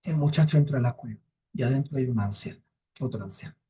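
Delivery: usual speaking voice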